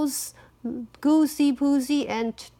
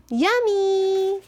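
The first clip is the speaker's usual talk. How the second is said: put-on high, drawn-out child voice; delighted yum; 8 semitones higher than usual. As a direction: savour it like a hungry kid. Girl tasting porridge.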